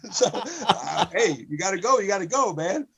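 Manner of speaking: giggling as he gets the line out; laughing quietly at his own punchline. Real, not acted.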